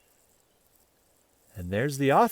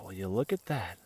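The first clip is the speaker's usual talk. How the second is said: ordinary delivery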